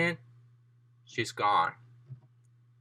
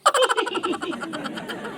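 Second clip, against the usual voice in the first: high villainous cackle; evil laugh. Acted. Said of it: "she was getting evil with her laughing".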